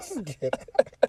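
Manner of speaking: high, slow words dissolving into laughter; laughing. Real, not acted. Friend telling the driver to get out, laughing. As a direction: order them out while cracking up. Jokingly kicking friend out of car.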